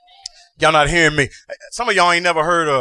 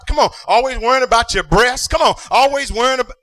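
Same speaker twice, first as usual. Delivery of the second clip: sing-song preaching over the organ; chanted church preaching; whooping preacher; 4 semitones higher than usual. Acted. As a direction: ride the rhythm like a revival preacher. Preacher at full force, organ music.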